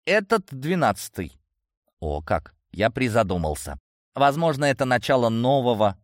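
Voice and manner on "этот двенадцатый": indignant. Acted. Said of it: "Она фыркнула".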